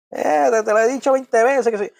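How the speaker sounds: high-pitched mock parental nagging; nagging cantaleta. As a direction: scold like a parent repeating the same old lecture. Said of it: "esto va más allá de la cantaleta".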